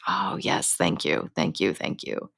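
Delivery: quiet murmured thank-yous; hushed thanks. Retold or imitated. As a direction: whisper your thanks down low. Grateful prayer to messages.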